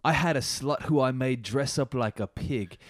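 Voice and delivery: put-on British accent; Andrew Griffiths. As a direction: read it as a lecherous British politician. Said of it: "This is British M P Andrew Griffiths".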